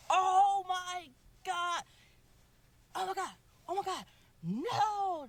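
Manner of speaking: high-pitched, drawn-out panicked cry, retelling the shock; retold panic. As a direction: wail it slowly, high, as if you just heard the news. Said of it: "I went berserk".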